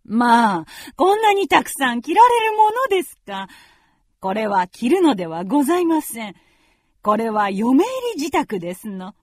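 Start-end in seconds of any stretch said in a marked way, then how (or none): 0.0-3.5 high, bright mock astonishment; lightly scoffing mother; amused surprise. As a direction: brush off the question with a scoff. Mother laughing off the question.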